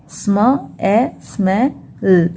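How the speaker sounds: "स्म ए स्मेल" very slowly, sound by sound. Shown as a demonstration. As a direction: break "स्मेल" into sm, e, sme, l with gaps. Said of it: "सिर्फ एक एल का sound ही हम produce करेंगे". ("स्मेल" को टुकड़ों में धीरे-धीरे बोलें)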